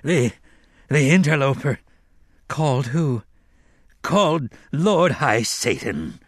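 ordinary delivery, nothing marked

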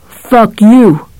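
ordinary delivery

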